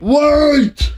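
high yelled call; Jeff yelling. Retold or imitated. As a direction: yell it high. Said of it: "when he yells".

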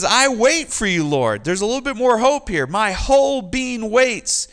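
hope rising in the psalmist's voice; hopeful longing. Acted. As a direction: let the hope climb as you wait on the Lord. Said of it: "He says".

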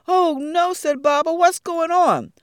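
high loud startled cry; alarmed. Acted. Big Baba startled by the leaf coming toward him. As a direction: jump at the strange leaf.

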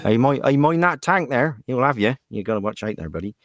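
lightly voiced West Country yokel; a hint of West Country farmer. Shown as a demonstration. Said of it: "sound like farmers who go out milking the cows".

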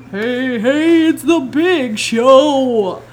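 hammed-up wrestling entrance chant, drawn way out; Big Show. Mom joking about the thud against the wall, mock wrestling entrance call. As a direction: belt it out like a wrestler's theme, slow.

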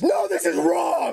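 high-pitched shouted objection; urgent protest. Acted. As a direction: cry out in alarm that it's all wrong. Acting out the wanted protest.

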